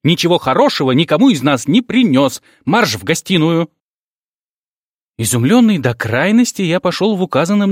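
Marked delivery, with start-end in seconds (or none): 0.0-3.7 high, loud furious command; angry yell. Acted. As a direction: order him off angrily. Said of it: "заорала она".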